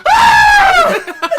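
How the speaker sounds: very high loud shriek; hammed-up scream; female anchor scream. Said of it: "female anchor voice is like".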